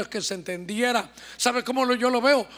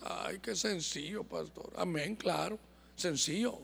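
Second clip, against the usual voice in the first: soft, dry mock reply, much quieter; mock unimpressed reply; 3 semitones lower, 11 dB quieter than usual. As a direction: shrug it off quietly as too easy. (respuesta burlona, muy baja)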